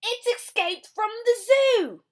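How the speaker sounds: high, loud indignant dad; Mister Blair. Acted. Mister Blair finding his cereal eaten, high and loud. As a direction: boom out the complaint. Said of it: "said Mister Blair".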